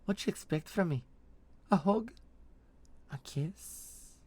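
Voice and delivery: high, mocking sing-song questions; mischievous teasing. Mercenary taunting her blushing partner, mischievous teasing. Veliana teases Vold mischievously.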